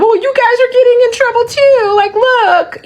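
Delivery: high, squealing mockery of gloaters; sing-song high-pitched taunt; mock excitement. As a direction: imitate excited onlookers way up high. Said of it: "these people really excited".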